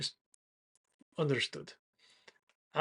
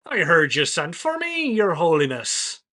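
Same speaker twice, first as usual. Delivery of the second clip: hobgoblin voice; 4 semitones higher, 10 dB louder than usual. Acted. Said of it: "And he goes".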